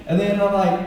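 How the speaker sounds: ordinary delivery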